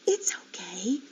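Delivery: soft whisper. Acted. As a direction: whisper it to comfort. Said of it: "she whispered".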